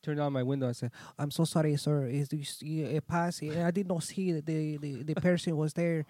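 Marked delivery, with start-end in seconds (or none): 1.2-6.0 apologetic, higher voice in mock Chinese accent; put-on Chinese-like accent. Apologizing to angry driver, mock Chinese accent. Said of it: "that sounded like a Chinese accent".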